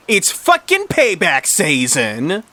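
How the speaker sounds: high, slow, stretched threat; acted menacing outburst; vengeful. A narrator's reading. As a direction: drag out the payback with relish. Martha threatens Caroline.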